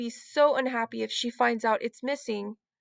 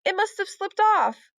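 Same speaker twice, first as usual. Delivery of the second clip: upset; 9 semitones higher, 4 dB louder than usual. A narrator's reading. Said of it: "said Heidi".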